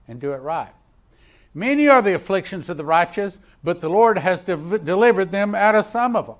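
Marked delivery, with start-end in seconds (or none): 1.5-6.3 raised, louder preacher's declamation; forceful preaching. Acted. Preacher declaring a promise to the congregation, forceful preaching. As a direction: proclaim the verse out loud.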